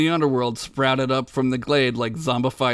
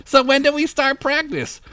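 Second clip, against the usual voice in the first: put-on eager kid voice; 12 semitones higher, 3 dB louder than usual.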